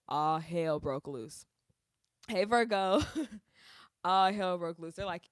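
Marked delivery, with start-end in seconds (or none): none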